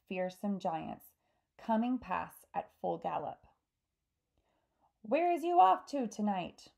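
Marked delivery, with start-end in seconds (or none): 5.0-6.7 high, loud call; raised storybook shout; BFG shouting. A narrator's reading. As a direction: call out to passers-by. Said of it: "shouted the B F G".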